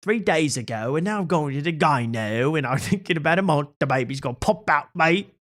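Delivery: high, mock British girl voice; lightly hammed-up imitation of a chatty Brit; playful mimicry of the influencer; mock Bonnie Blue. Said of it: "she's like".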